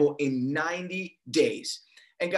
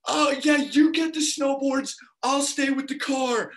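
high, raised voice bowing out; mock nervous agreement; 8 semitones higher, 4 dB louder than usual. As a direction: imitate a panicky kid opting out. Acted reply in panicked scene.

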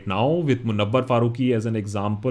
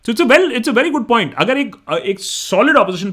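higher, louder, quick; excited; 9 semitones higher, 5 dB louder, faster than usual. Real, not acted. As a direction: jump in with enthusiasm. Excited reply.

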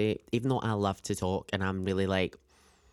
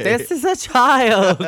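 much higher, louder, slow squeal; mock squeal; 12 semitones higher, 11 dB louder, slower than usual. Describing the date to a friend, mock squeal. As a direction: squeal it in disbelief. Repeats inner thought, squealing.

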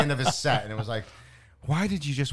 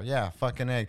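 normal talking voice

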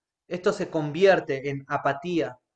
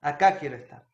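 peaceful thanks; 5 semitones lower, 4 dB quieter than usual. Retold or imitated. Preacher voicing his own prayer of rest, peaceful. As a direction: breathe out relief and contentment.

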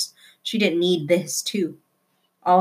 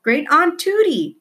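high, slow loud call; shouted; 10 semitones higher, 4 dB louder, slower than usual. A narrator's reading. Flora calling to her great aunt, shouting. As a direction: call out to the old lady. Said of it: "she shouted".

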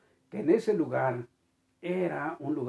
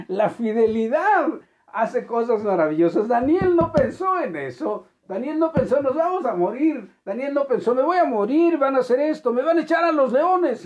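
loud, high preaching about Daniel's faith; full-force preaching; 8 semitones higher, 6 dB louder than usual. Acted. Preacher telling the congregation about Daniel, in a loud high preaching voice.

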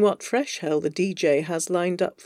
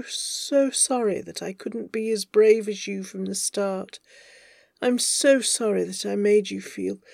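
tender, pleading confession in a slightly raised voice; earnest lover. Acted. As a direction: pour out love and apology gently. Avery's pleading love confession.

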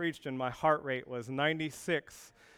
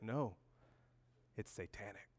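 lower, much softer, deadly serious coworker; grave hush; 5 semitones lower, 11 dB quieter than usual. Retold or imitated. Coworker warning pastor about his drink, in a grave hush. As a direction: drop to a grave hush as if sharing a dark secret. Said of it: "He's like".

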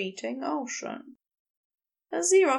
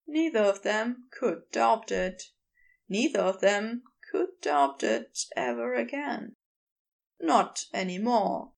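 usual speaking voice

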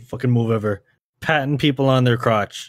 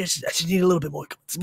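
crack addict voice; 4 semitones higher, 6 dB quieter, faster than usual. Retold or imitated. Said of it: "Goku sounds like a crack addict".

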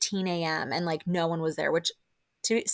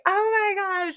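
squealed much higher, shocked; shocked scream; 14 semitones higher, 3 dB louder than usual. Retold or imitated. Podcaster reliving her shriek at the naked chef, high squeal. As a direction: shriek it like you just saw him naked. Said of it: "I literally screamed and was like".

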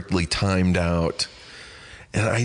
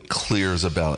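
ordinary talk

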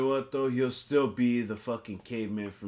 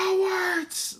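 high yelled insult; retold yell. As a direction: shout it at the teammates. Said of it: "he's like".

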